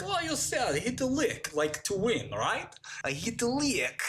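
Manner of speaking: high, slow, delighted imitation of a Russian accent; Russian hacker proudly trying the new slang. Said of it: "It's like".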